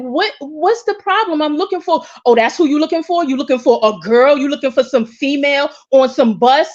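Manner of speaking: defensive snap. Retold or imitated. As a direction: fire back, offended. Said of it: "I'm like".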